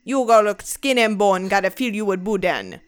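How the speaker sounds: put-on Louisiana relative's Cajun accent; put-on straight Cajun accent; imitated Cajun accent. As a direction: talk like Cajun family offering coffee. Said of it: "they talk to me like that".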